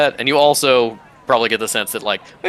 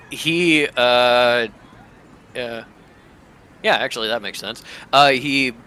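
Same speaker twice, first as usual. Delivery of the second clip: normal talking voice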